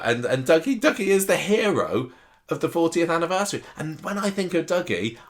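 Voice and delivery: normal talking voice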